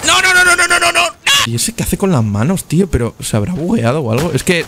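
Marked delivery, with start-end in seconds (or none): 0.0-1.0 grito agudo de pánico; no no no desesperado; panicking gamer. Real, not acted. Muere en la partida. (jugador en pánico gritando)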